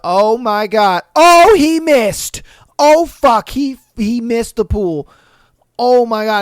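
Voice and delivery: loud, high cries of alarm; horrified shouting. Acted. Bartender watching a kid miss the pool, horrified shouting. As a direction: act out yelling in horror as it happens.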